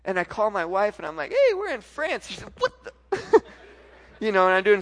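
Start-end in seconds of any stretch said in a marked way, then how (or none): 1.3-2.3 very high, loud phone greeting; excited announcement. Retold or imitated. Retold call to wife. 2.6-3.4 very high, loud imitation of a wife; shocked reaction. Wife's retold shocked reply.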